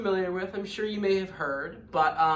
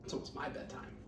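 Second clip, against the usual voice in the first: a real whisper, much softer; whispered aside; 14 dB quieter, faster than usual. Real, not acted. Teacher slipping a hushed aside to her listeners, whispered.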